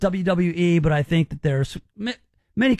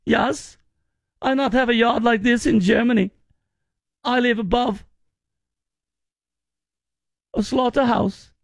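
put-on higher-pitched, broken-English German accent; mock German accent; 5 semitones higher than usual. Host voicing a German visitor's posts, mock German accent. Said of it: "you should say in a German accent".

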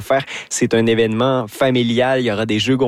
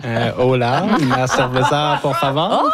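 ordering a beer in Spanish through a laugh; laughing mock Spanish. Columnist jokes back in Spanish, laughing. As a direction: joke it out with a giggle.

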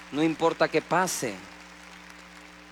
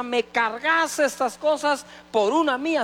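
commanding Roman; 8 semitones higher, 5 dB louder, slower than usual. Retold or imitated. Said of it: "un romano le decía".

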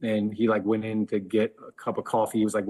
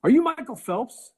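high, louder mock-starstruck question; pitched way up in amazed recognition; excited stranger; 12 semitones higher, 6 dB louder than usual. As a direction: imitate an onlooker blurting out a guess.

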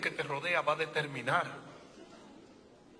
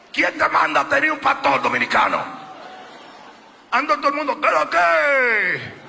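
preaching; 10 semitones higher, 11 dB louder than usual. Real, not acted. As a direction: tease the congregation, full voice. Preaching, mocks Dominican speech. (predicación en voz alta, burlona)

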